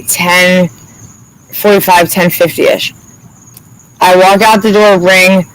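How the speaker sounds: normal talking voice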